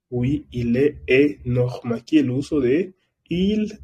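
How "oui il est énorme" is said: il est énorme spoken slowly; slow it down for learners; slow model. Shown as a demonstration. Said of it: "slow model sentence".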